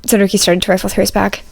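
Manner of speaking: ordinary delivery, nothing marked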